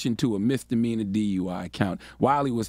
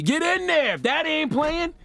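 hollering. Acted. Host capping his wobble riff, hollering. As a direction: holler it out as the chant's payoff.

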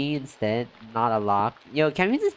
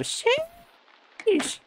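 very high squeaked sheesh; shrieked exclamation. Real, not acted. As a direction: squeal it in disbelief. Reacting to chat.

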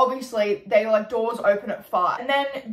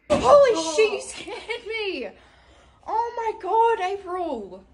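high startled yelp into laughter; startled scream; 9 semitones higher, slower than usual. Real, not acted. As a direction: jump and shriek in real fright. Startled by friend appearing.

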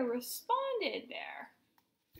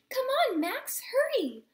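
high, louder urging call; 11 semitones higher, 5 dB louder than usual. A narrator's reading. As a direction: call a friend to hurry along. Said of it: "Lulu called".

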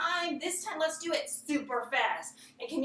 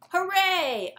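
cheer; 7 semitones higher, 3 dB louder than usual. Shown as a demonstration. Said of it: "A cheer means I did it".

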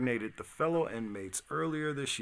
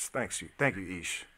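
ordinary talk, nothing marked

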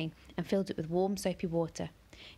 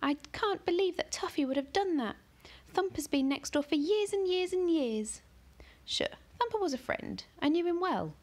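put-on very high, upset girl's voice; girl lamenting her neighbour's rabbit, very high; distraught girl; 11 semitones higher than usual. A narrator's reading. Ellie distraught.

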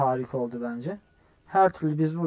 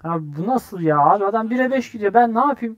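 loud complaint climbing higher; exasperated protest; 6 semitones higher, 8 dB louder than usual. Real, not acted. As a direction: protest louder and higher as it builds. Protests a lopsided score. (giderek yükselen isyankâr sitem)

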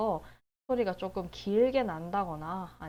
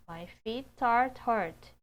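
My feet are hurt very slow, louder; slow model reading; 3 semitones higher, 4 dB louder, much slower than usual. Shown as a demonstration. Teacher models sentence slowly for learners. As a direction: read it slowly with care.

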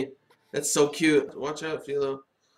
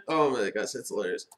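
ordinary talk, nothing marked

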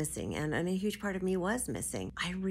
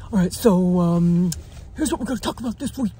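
lightly voiced imitation of her husband; imitating husband's voice; 2 semitones higher, 12 dB louder than usual. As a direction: tease him by copying his airy delivery. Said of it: "you have air in your voice".

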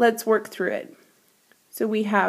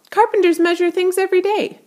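eager realization; 10 semitones higher, 4 dB louder than usual. A narrator's reading. Radius realizes an idea.

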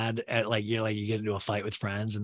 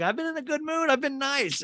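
much higher whiny protest; whiny Shep. Retold or imitated. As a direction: whine it in a high, put-upon voice. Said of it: "Shep's like".

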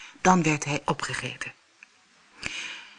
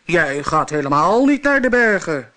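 louder monster roar; 6 dB louder than usual. Acted. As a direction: bellow it like a hungry troll. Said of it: "brulde het monster".

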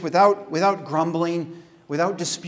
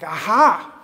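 aha exclamation; 5 dB louder than usual. Shown as a demonstration. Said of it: "you ever have an aha moment".

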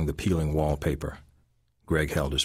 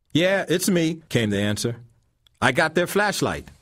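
put-on higher, louder old man's answer; old man Lemon Brown; 11 semitones higher, 4 dB louder than usual. A narrator's reading. Lemon Brown answering Greg after the fight, higher and louder. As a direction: answer up the stairs, pleased.

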